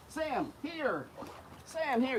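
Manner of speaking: high bright call; calling the dog. Real, not acted. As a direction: call him out of the water.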